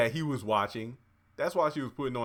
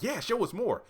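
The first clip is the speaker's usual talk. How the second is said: eager; 10 semitones higher than usual. Retold or imitated. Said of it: "And I'm like".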